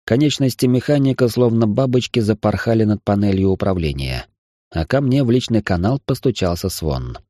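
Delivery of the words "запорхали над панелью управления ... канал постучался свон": usual speaking voice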